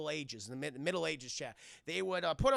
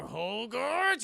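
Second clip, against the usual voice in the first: drawn-out, louder mock scolding; mom's outraged call; 4 semitones higher, 5 dB louder than usual. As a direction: stretch the name in hammed-up outrage. Mom about to slap him.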